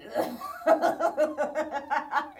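lautes, herzhaftes Lachen; hearty laugh. Real, not acted. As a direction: lach los, richtig befreit. Lacht über die Szene. (herzhaftes lautes Lachen)

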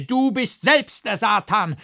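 loud, high, slowed accusation; frantic shouting. A narrator's reading. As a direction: scream at the devil, out of your mind. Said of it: "schrie ich außer mir".

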